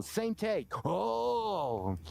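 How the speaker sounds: high, drawn-out "Oh"; a dramatic double-take gasp "Oh". Retold or imitated. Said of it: "that double take".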